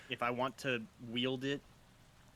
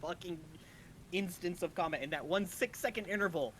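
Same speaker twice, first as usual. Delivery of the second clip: excited; 5 semitones higher than usual. Real, not acted. Outraged at damage taken.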